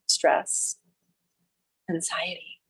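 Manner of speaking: breathy whispered words, trailing away. Acted. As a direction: let the words fall into a whisper. Naming feelings in meditation.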